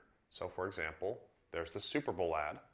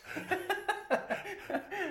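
high laugh. Real, not acted. Reminiscing about local car ads.

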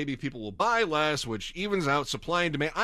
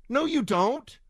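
sharp, pitched-up rebuttal; angry retort; 10 semitones higher, slower than usual. Real, not acted. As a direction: snap back at the empty promise. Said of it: "answering the line".

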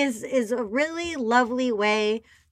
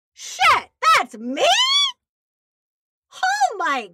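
surprised; slower than usual. Retold or imitated. Stunned hearing her own show.

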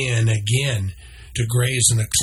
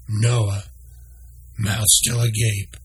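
usual speaking voice

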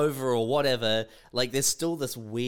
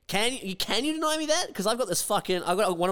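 mock indignant; 8 semitones higher than usual. Mock defiant arguing with cops.

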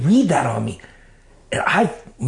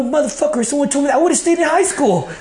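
much higher, laughing in disbelief; half-laughing, mock-outraged, much higher; laughing outburst; 13 semitones higher than usual. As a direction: squeal it out through a laugh. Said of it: "I go".

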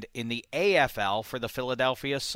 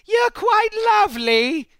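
high, slow put-on English voice; a prim, much higher, slow English lady brushing him off; mock snooty English charm; posh flirt; 4 dB louder, slower than usual.

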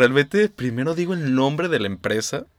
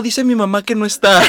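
put-on higher, louder innocent kid; naive child voice; 8 semitones higher, 7 dB louder than usual. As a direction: blurt it out like a little kid. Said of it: "tú decías".

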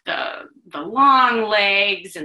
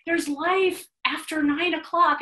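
excited; 9 semitones higher than usual. Retold or imitated. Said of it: "And I go".

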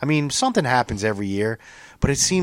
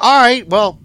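higher and louder imitated put-down, going for the kill; cutting comeback; 10 semitones higher, 7 dB louder than usual. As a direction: deliver the insult like a mock jab. C T's cutting comeback retold.

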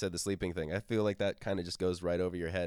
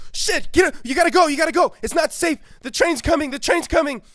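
high, loud panicked yelling, much higher and louder; panicked sleep-talking; 16 dB louder than usual. Retold or imitated. Friend sleep-talking warns of a train, high loud panic. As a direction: shout the warning in a high frantic panic. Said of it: "Todd would wake up in the middle of the night going".

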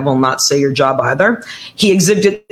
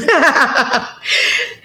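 bright amused laugh, pitched up; high laugh; 11 semitones higher than usual. Real, not acted. Laughs after the pronunciation help.

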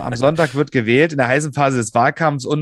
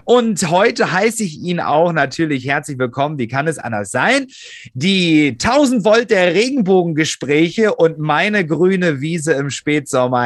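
usual speaking voice

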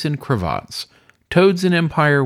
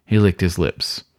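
plain reading voice

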